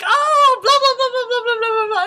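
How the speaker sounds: high, loud nonstop babble; babbling ajumma. Retold or imitated. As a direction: imitate an ajumma chattering away excitedly. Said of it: "babbling at me in Korean".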